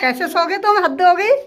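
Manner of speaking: loud, high exclaiming in disbelief; loud dismay. Real, not acted. Finding sister-in-law asleep after eating, loud dismay. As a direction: cry out in loud mock outrage.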